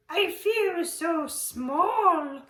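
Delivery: high, very slow small voice; tiny snail voice. Acted. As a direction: say it small and awed. Snail speaks to the whale.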